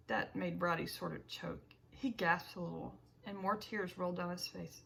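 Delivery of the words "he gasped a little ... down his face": ordinary delivery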